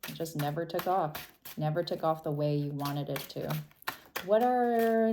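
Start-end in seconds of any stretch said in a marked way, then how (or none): none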